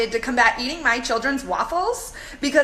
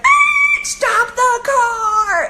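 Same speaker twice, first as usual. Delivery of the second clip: high, loud, slow shriek; shrieking stop the car; 11 semitones higher, 7 dB louder, much slower than usual. Retold or imitated. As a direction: yell at the driver to stop now. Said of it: "I was like".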